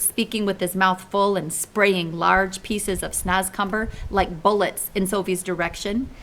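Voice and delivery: ordinary delivery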